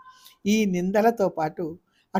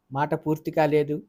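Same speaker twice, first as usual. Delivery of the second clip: plain reading voice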